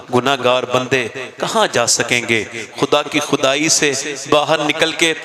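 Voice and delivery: low, hushed pleading to God; tearful prayer. Acted. Sinful woman cries alone to God, low tearful plea. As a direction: pray through tears, low and broken. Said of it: "रोने लगी".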